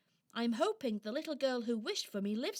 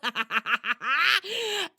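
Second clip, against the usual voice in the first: gloating squirrel laugh; 4 semitones higher, 5 dB louder than usual. Acted.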